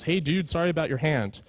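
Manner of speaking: normal talking voice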